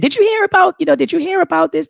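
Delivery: high, urgent mother bursting in with news; excited mother. Retold or imitated. Mother rushing in to tell her son the news, high and excited. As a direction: mimic mom rushing in, voice way up.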